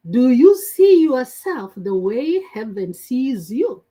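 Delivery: ordinary talk, nothing marked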